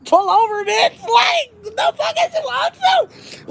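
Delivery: high, hollered police order; comically high imitation of a bullhorn; mock bullhorn order. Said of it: "he got on the bullhorn".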